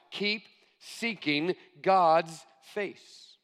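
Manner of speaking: each word spaced out for note taking; slow emphatic dictation. Shown as a demonstration. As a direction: repeat it slowly so they can write it down. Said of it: "I encourage you to write that down".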